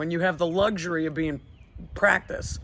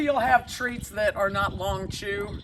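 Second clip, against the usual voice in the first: ordinary delivery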